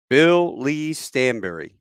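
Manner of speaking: each name given room, projected; announcing his name. Retold or imitated. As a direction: report your full name clearly to a sergeant. Giving his name to the sergeant.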